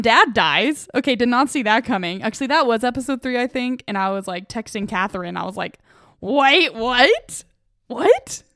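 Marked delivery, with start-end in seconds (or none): 6.2-8.4 retold shock. Texting friend in shock.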